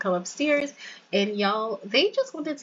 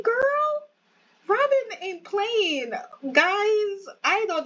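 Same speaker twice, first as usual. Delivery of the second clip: real surprise, much higher pitched; higher, squealing shocked gossip; 7 semitones higher than usual. Real, not acted. Spots Robin with sugar daddy.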